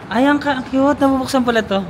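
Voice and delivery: high squeal of delight; delighted surprise. Real, not acted. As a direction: gush at the surprise. Discovers window opens. (tuwang-tuwang gulat)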